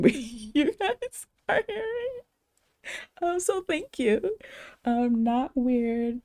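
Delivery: giggly, touched thank you; laughing. Real, not acted. Touched by viewer's compliment.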